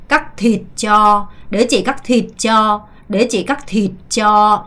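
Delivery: "cho" drawn out long at the end. Shown as a demonstration. Teacher models "cho" for students, drawn out. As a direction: stretch "cho" slowly. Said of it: "쩌는 좀 끌면서 쭉 가시는 게 좋습니다".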